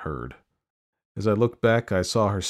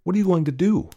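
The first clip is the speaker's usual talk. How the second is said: high, louder urgent question; alarmed burglar; 12 semitones higher, 5 dB louder, faster than usual. Acted. Burglar questions the lady after murder, alarmed. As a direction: ask as a shocked witness, voice raised in pitch.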